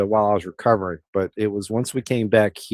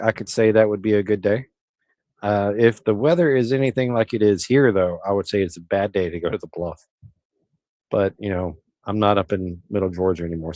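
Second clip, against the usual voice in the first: usual speaking voice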